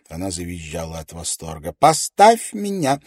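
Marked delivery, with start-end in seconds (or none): 1.7-3.0 high, slow, squealing plea; playful demand. A narrator's reading. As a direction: squeal it playfully while spun around. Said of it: "игриво потребовала она". (игривый визг)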